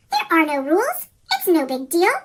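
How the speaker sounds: plain reading voice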